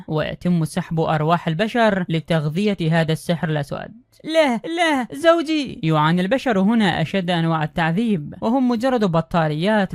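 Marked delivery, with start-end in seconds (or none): 4.2-5.8 high pleading cry. Acted. Wife wails as her husband is dragged away, pitched high in panic. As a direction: voice jumping up in panic, no no.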